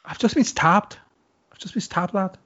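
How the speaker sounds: put-on Scouse; Scouse accent. Bantering with a co-host in Scouse. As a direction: play it as mock Scouse. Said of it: "No, it's Scouse".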